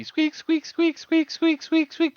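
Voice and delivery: high, loud, repeated squeaks; imitated chair squeak. Dad imitating the office chair that drove his wife crazy, high squeaking.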